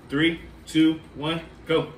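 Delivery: ordinary talk, nothing marked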